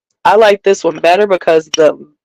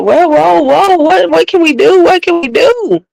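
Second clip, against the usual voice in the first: panicked whoa; 9 semitones higher than usual. Retold or imitated. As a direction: play the friend who just heard bad news, alarmed and higher. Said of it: "I was about to be like".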